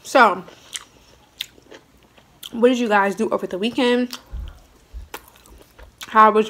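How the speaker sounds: talking between bites; chewing while talking. Real, not acted. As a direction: mouth partly full. Seen 1: Bites broccoli at 4.3, food visible in open mouth 5.3.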